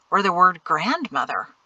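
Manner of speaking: usual speaking voice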